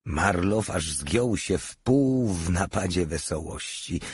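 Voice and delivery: ordinary delivery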